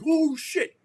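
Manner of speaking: sudden high alarmed cry; startled. Retold or imitated. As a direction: caught by surprise under fire. Rounds spraying over his head.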